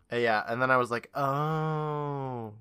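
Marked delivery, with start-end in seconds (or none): 1.1-2.5 long, drawn-out "oh" of understanding; dawning realization. Retold or imitated. Host recalls finally getting it, dawning realization. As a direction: stretch it out as it sinks in.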